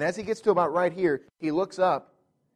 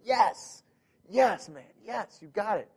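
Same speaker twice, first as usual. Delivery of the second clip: excited yes; 5 semitones higher than usual. Retold or imitated. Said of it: "and, like".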